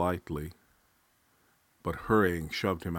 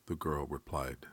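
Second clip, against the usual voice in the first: ordinary delivery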